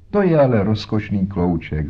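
ordinary delivery, nothing marked

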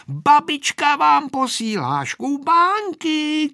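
put-on high, loud, slow child's call; girl shouting. A narrator's reading. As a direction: shout it out slowly as little Aneška. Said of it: "křičela Aneška". (dětsky vykřikovat, vysoko a nahlas)

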